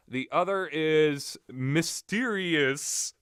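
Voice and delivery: usual speaking voice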